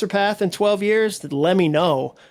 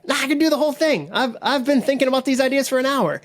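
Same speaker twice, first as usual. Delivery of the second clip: high, fast, overconfident brush-off; cocky brush-off; 8 semitones higher, faster than usual. Retold or imitated. Younger self scoffing at a mentor's advice, cocky and high. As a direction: wave off the advice like a know-it-all kid.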